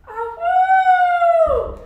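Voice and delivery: high drawn-out wolf howl. Acted. Wolf howl after his death.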